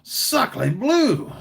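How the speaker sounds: high, loud hammed-up French exclamation; exaggerated French accent; mock French accent. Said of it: "I failed French".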